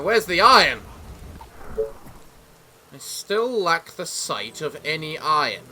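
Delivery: usual speaking voice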